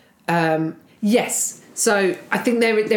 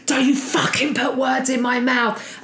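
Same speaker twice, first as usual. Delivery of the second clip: higher mock shout at her; screaming; 5 semitones higher than usual. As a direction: shout it back like he did. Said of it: "he was screaming at me".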